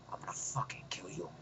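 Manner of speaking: very soft, fully whispered menace; acted hushed threat. Tearful villain's threat, acted.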